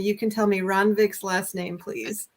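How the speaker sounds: usual speaking voice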